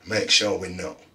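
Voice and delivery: short and sharp, dismissive; curt. A narrator's reading. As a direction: cut him off curtly. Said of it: "said Ted curtly".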